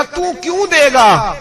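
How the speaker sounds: high, drawn-out retold question; incredulous challenge. Widow asking the governor why he would pay, incredulous. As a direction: question his offer in disbelief.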